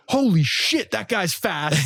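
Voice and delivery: high, astonished exclamation from the sideline; amazed bystander. Retold or imitated. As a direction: blurt it out in shock. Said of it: "hear someone ... say".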